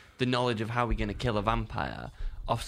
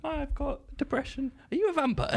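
mock sufferer.

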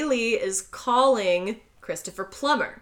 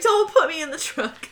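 higher-pitched mock protest; mad; 7 semitones higher than usual. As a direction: imitate an annoyed objection, pitched up. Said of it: "obviously really mad he put her in the trunk".

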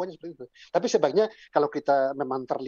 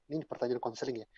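ordinary talk, nothing marked

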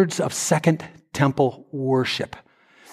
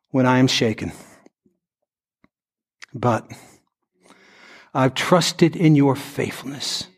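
scripture reading. Acted. Preacher reading Psalm 13 to the standing congregation, solemn. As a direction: read it as a prayer.

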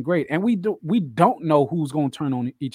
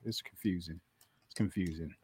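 quiet aside; 10 semitones lower, 14 dB quieter than usual. Real, not acted. Very quiet far mutter.

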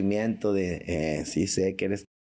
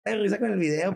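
put-on higher, fast girlish chatter; mock gossiping woman; 8 semitones higher, faster than usual. Comedian imitates a woman sharing the video, fast and high. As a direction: rattle it off high and quick. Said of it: "Va a decir".

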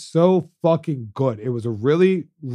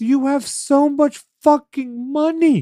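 exasperated rant; 11 semitones higher than usual. Acted. Ranting at Peacock.

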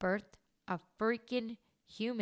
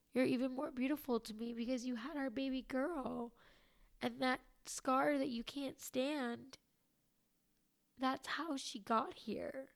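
tearful, higher, wavering voice; crying; 5 semitones higher than usual. Real, not acted. Quoting husband's praise of her scar, still tearful. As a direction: get through the words while still crying. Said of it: "I'm officially crying".

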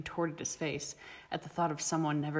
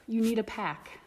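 higher, pressing hard on need; urgent insistence; 6 semitones higher than usual. A narrator's reading. Brina urges Remus to join, insistent. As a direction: push him to accept it.

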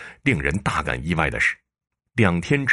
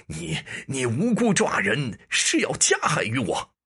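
much higher, stammering furious shout; cornered official bellowing back in fury; roaring in rage; 10 semitones higher than usual. Acted. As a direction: explode at the prefect, voice cracking high. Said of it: "陈县令闻言大肆咆哮".

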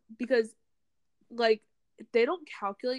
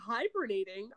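normal talking voice

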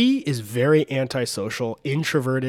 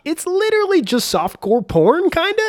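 amused disbelief; 5 dB louder than usual. Real, not acted. Amused disapproval of DJ.

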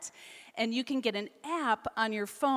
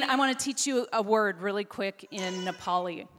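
ordinary delivery

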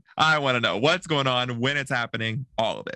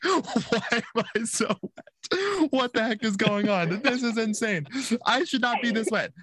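laughing disbelief; 9 semitones higher than usual. Retold or imitated. Telling friends about the ride, laughing high disbelief. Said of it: "I'm like".

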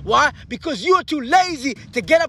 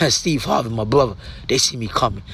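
low, soft, intimate voice over the music; hushed motivational talk; 5 dB quieter than usual. Acted. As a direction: lean in close and speak softly to one listener. Quiet intimate stretch over music.

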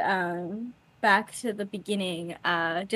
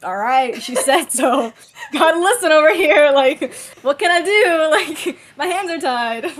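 resigned Rodney; 6 semitones higher, 9 dB louder than usual. Retold or imitated. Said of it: "he's like".

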